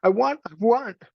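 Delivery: put-on high whimpering child, a touch of sobbing; childish mock sobbing. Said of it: "sobbed Meg".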